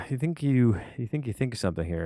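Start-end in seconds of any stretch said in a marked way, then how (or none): none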